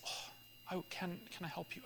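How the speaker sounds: softly whispered, almost unvoiced; retold whispered offer of help. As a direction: whisper it as if not to startle her. Offering help to the crying neighbor.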